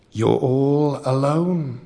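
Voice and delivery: slow troll voice. Acted. Troll sniffs the boy.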